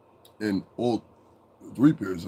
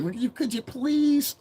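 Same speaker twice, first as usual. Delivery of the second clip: very high, slow simpering plea; mock nice guy; slower than usual. Mocking the idea bosses must be nice, high polite voice. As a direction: beg in an exaggerated dainty voice. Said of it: "I gotta be nice for".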